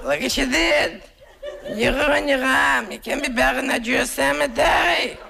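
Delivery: very high, scolding aunt voice; aunt scolding about the tattoo, high squawk; shrill aunt. Retold or imitated. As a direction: squawk at him like a horrified aunt.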